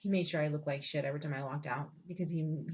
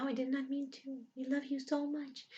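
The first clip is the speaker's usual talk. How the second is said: mocking sugary voice; 7 semitones higher than usual. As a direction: parody a fake-sweet in-law. Mocking in-laws' sweet apology.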